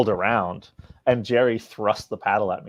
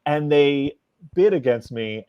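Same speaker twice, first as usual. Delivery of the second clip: normal talking voice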